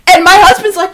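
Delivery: usual speaking voice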